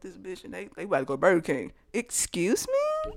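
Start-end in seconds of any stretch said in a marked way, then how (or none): 1.9-3.1 slow, very high, airy disbelief; mock incredulous. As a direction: stretch it out in hammed-up shock. Girlfriend sees him with another woman.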